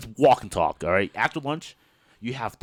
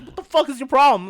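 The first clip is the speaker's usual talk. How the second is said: angry; 11 semitones higher, 6 dB louder than usual. Retold or imitated. Guy angry.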